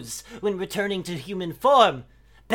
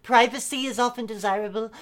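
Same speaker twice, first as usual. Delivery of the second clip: ordinary delivery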